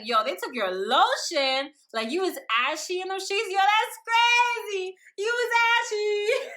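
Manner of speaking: loud, rising mock disbelief; high, loud playful roasting; teasing outburst. Teasing him in retold chat.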